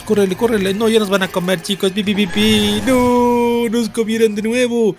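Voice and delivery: high, loud frantic shouting; panicked cry. Real, not acted. Player yelling to friends as they get eaten, high panicked cries. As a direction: yell as they get caught.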